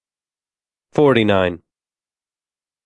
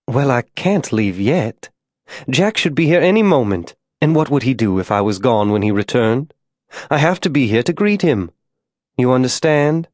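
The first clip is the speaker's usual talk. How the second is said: insistent devoted wife; 2 semitones higher than usual. Acted. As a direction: explain it earnestly, as if self-evident. Molly explains she must wait.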